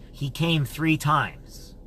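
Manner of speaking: each word laid down very slowly; slow solemn reveal. Retold or imitated. Stranger tells the host he came three times, very slow and solemn.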